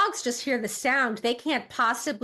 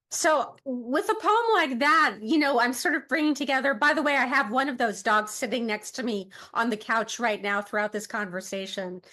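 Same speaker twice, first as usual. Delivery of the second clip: ordinary delivery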